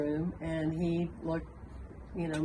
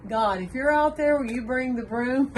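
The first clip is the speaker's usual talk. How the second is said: put-on higher, louder child's call outward; yelling into dark; 6 semitones higher, 6 dB louder than usual. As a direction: call God from the doorway. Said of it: "he yells".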